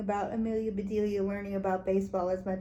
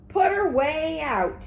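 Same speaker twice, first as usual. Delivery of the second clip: higher, louder and slow; angry shout; 6 semitones higher, 5 dB louder, slower than usual. A narrator's reading. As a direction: shout it at the coach. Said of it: "they shouted".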